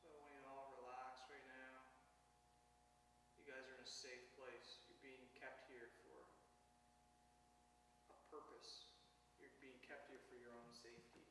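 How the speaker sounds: softly soothing the captives; calm them like a guide; quiet captor promising safety; reassuring calm. Acted. Director calms the captive characters.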